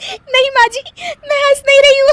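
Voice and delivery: very high, loud words breaking into laughter; denying while laughing. Acted. Daughter-in-law to mother-in-law, denying it while laughing. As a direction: protest helplessly through a laughing fit. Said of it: "फिर से हंस रही हो और बोल रही हो कि हंस नहीं रही".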